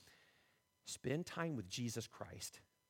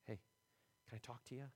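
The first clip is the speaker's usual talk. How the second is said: soft, quiet appeal to an angry crowd; gentle calming call; 3 semitones lower, 9 dB quieter, faster than usual. Retold or imitated. Paul calming the angry mob, softly. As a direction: hush them gently, hey, hey. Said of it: "He said".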